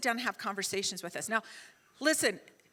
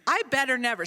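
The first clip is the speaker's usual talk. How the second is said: stern parental warning; 5 dB louder than usual. Retold or imitated. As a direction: lay down the law firmly. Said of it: "says it to me in the same way".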